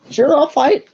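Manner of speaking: high-pitched voice for Grail; telepathic Grail. Acted. Grail agreeing to fight by telepathy, high character voice. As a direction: pitch it well up, unlike your own.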